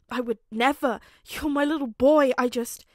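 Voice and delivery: desperate to be believed; anguished plea. Acted. Father begging his son, anguished and loud.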